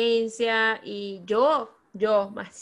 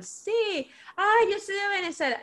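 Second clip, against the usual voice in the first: higher, bright imitation of the woman answering; high mock Venezuelan lady; 10 semitones higher than usual. Woman answers the narrator in the shop, in a high imitating voice. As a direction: play the delighted stranger, pitched up.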